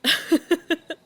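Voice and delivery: loud, high bark of laughter; loud hearty laugh. Acted. Queen laughing at the blood-on-carpets quip, loud and delighted.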